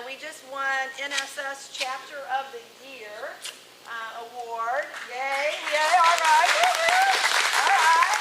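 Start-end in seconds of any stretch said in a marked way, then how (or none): 5.1-8.2 high, loud woo-hoo; excited cheer. Real, not acted. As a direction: celebrate the win out loud. Cheering their award win.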